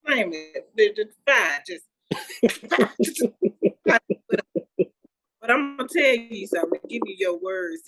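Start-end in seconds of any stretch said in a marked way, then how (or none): 2.1-3.8 real high-pitched giggling fit; laughter. Real, not acted. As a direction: burst out laughing mid-sentence. Cracking up at the show's opening.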